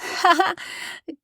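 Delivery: put-on high burst of boyish laughter; high, giddy laugh of a boy; boy's loud laugh. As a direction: laugh out at the odd old man. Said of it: "interrupted him with loud laughter".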